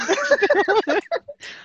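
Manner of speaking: high mock giggle from his buddy; imitated giggle. Friend stuck at the counter, a high mock giggle. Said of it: "He goes".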